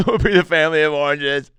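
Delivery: high, loud mock-tearful wail; hammed-up crying agreement; choked up. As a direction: act overcome, squeezing the words out high. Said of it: "that got me really choked up".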